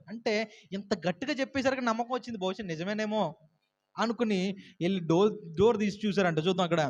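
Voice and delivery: usual speaking voice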